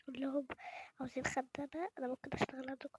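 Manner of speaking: quick hushed rehearsal; whisper. Real, not acted. Vlogger rehearsing asking for maid work, whisper.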